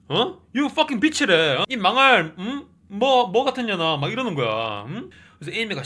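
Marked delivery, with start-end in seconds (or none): 0.5-4.0 loud, high retold insult; mock cursing. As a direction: spit out the curse in pretend anger. Mimicking husband cursing his returned wife. (욕설을 높고 크게 흉내 내는 말투)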